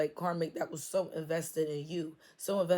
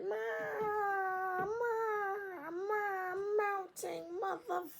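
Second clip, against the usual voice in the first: much higher squeaky stammering; jealous karmic. Retold or imitated. Karmic in the background mocked in a high squeal. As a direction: squeak it out like a flustered hater. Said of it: "a karmic that's just in the background like".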